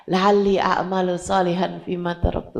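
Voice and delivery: ordinary talk, nothing marked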